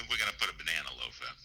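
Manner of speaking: quick, soft East Coast menace; veiled threat. Real, not acted. Caller shows the neighbor his hometown phrase, veiled threat. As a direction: slip it out low and fast like a veiled threat. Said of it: "the way you're saying it, it sounds like a threat".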